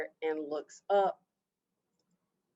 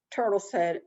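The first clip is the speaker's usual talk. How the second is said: usual speaking voice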